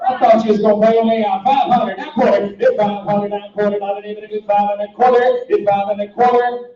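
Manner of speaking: fast rolling auction chant; auctioneer. Acted. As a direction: rattle off bids like an auctioneer.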